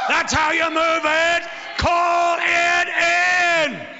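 high, loud preacher's chant building to a shout; preaching at full force. Acted. Preacher whipping up the church, rising to a roaring shout. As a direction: drive the congregation up with each repeat.

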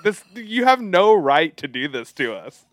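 hammed-up exasperated tirade, easing slightly at the end; mock anguished protest. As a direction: rail at him in playful outrage. Mock tirade at the actor Denzel.

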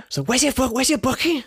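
high imitated Scouse accent. Retelling a Scouse woman at a bowling alley, Scouse accent. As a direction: mimic a Scouse girl asking. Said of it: "she's like".

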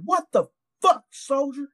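very high-pitched mock sergeant outrage; high, incredulous sergeant impression; outraged first sergeant. Retold sergeant's shocked reprimand.

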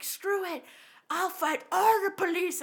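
high, loud mock bravado; crazy aggressive man. Crazy guy defies the coming police, mock aggressive. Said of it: "getting real crazy aggressive".

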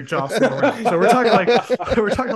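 giggling as the thought takes over; laughing. Real, not acted. Telling friends about rally pace notes, breaking into laughter.

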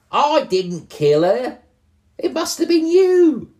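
very high, loud accusing goblin; squeaky goblin. Acted. Goblin quarrel voiced.

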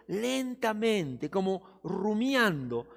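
drawn-out, very slow word; slowly. Shown as a demonstration. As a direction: stretch lentamente out slowly, as if ruminating. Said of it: "lo leas conmigo con asombro".

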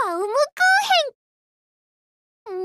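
high, drawn-out proud reveal; grand announcement. Acted. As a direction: unveil it like a trophy. Grand naming of the sweet.

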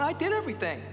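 high-pitched protest to God; acted cry of a wronged believer; anguished sufferer. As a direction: plead as someone hurt and bewildered. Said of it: "you're bleeding out on the cross going".

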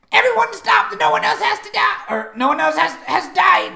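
very high, loud shrieked plea; shouted, pitched far up, desperate to halt the fight; Burb's frantic plea. Acted. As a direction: scream it in a high creature voice. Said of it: "He says".

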